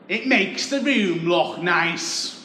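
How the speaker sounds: slow, lifted mock mum; mum's earnest voice. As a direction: drag it out like a fussy mum. Mum explaining the bath mat.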